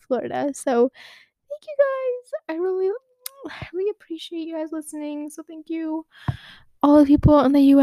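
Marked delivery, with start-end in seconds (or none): 1.5-6.0 emotional thanks. Real, not acted. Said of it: "tearing up right now".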